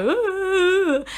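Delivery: high drawn-out wail; mock sobbing wail. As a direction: wail in exaggerated self-pity. Wails at end of upset rant.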